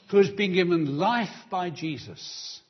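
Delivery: ordinary talk, nothing marked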